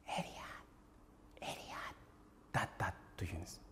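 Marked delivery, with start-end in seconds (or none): 0.1-1.9 soft whispered call; God whispering Elijah's name. Shown as a demonstration. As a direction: breathe the name close to the ear. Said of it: "静かにささやく声、".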